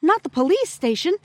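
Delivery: high, loud cry of dread; alarmed plea. A narrator's reading. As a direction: plead in alarm. Second frightened voice from the darkness.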